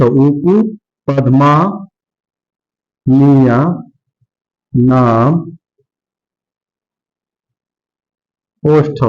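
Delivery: each word set apart by long pauses; ऊपु, पद्मानीय, नाम, ओष्ठ spoken one at a time with long gaps; slow syllabic recitation. Shown as a demonstration. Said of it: "sutra broken word by word". (सूत्र को धीरे-धीरे शब्द-शब्द अलग करके बोलना)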